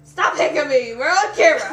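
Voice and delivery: half-joking yell; loud scolding. Real, not acted. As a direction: shout at her to stop. Scolding friend on camera.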